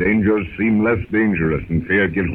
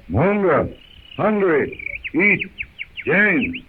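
Tarzan's warning; 8 semitones higher, much slower than usual. Acted.

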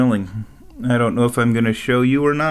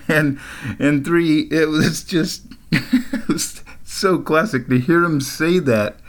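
higher, slow words broken by laughter; laughing while talking; 5 semitones higher than usual. Real, not acted. Narrator recalls coworker's classic line, laughing through it. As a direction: crack up while trying to explain. Cracking up at retold story.

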